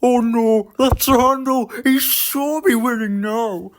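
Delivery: high, slowing worried car voice. Acted. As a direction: dread at seeing the rival. Car spots his rival.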